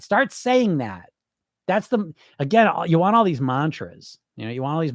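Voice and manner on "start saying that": slow, deliberate. Shown as a demonstration. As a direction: Start saying that, drawn out slowly.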